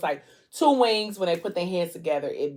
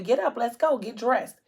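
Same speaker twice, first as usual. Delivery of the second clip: brisk order in a raised pitch; retold get-moving command, pitched up; urging him up; 6 semitones higher than usual. Woman hustling patient out of hospital.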